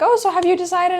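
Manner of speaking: high-pitched waiter's voice; chirpy waiter. Retold or imitated. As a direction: chirp it like a server at the table.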